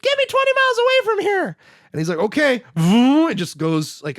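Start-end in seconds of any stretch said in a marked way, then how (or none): 0.0-1.6 very high, louder, fast squeaky order; squeaky kid demand. Retold or imitated. 2.2-3.3 higher, louder vroom sound effect; mock takeoff vroom. Robot obeys, ship takes off.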